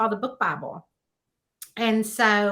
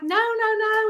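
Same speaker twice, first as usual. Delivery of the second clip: high-pitched, quick-to-dismiss protest; retold reassuring protest; 12 semitones higher than usual. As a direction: wave off an apology in a high voice. Retold lady waving off apology.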